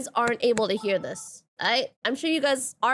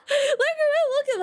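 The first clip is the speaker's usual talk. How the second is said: high, laughing delight; squealing look at him through laughter; 13 semitones higher than usual. Real, not acted. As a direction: giggle it out, charmed by him. Laughing at something on screen.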